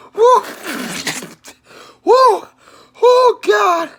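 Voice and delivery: high whoop from spicy heat; high-pitched gasps of real heat distress; burning-mouth whoops. Real, not acted. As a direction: whoop and moan at the burn. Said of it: "my mouth is on fire".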